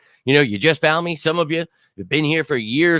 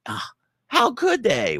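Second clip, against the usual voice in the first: sarcasm; 12 semitones higher, slower than usual. Real, not acted. Sarcastic mock shock at media.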